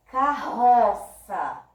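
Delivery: "carroça" slow, with RO loud; stressed middle syllable. Shown as a demonstration. Teacher showing which sound comes out strongest, slow "carroça" with loud RO. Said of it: "o som que saiu mais forte".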